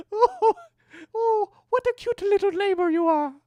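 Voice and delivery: very high squeaky cooing; squeaky baby talk. Pretend. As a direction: squeal it like fussing over a puppy.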